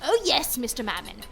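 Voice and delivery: ordinary talk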